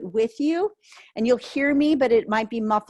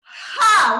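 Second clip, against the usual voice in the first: forceful exhale; 8 semitones higher, 6 dB louder than usual. Shown as a demonstration. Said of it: "one more".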